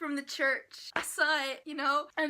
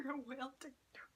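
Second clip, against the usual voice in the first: whisper; 11 dB quieter, much faster than usual. Real, not acted. Whispered laughing repeat.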